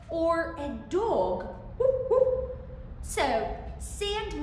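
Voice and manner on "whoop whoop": high loud yippy bark; dog woof. Shown as a demonstration.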